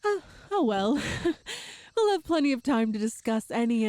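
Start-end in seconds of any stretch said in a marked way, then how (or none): 1.1-1.7 quick high playful giggle. Acted. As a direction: giggle past the ethics. Brushing off his concerns.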